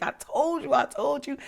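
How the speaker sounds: high, gleeful told-you-so; triumphant told-you. Retold or imitated. She crows at the girl who finally did it, high and gleeful. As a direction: sing it out high and pleased.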